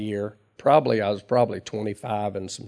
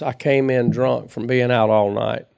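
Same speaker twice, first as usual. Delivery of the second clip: ordinary delivery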